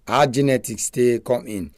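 ordinary talk, nothing marked

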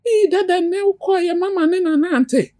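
put-on high childlike voice; imitating a small child. Mother playing her toddler, high childlike voice. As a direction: mimic a little kid telling daddy.

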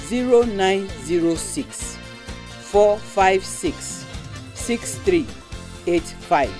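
digits read slowly, one by one; slow number dictation. Shown as a demonstration. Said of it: "Make I take am again".

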